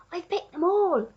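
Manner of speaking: ordinary delivery, nothing marked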